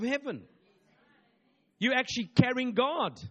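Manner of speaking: loud, high preaching at full force. Acted. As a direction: drive it home to the congregation. Preaching climax.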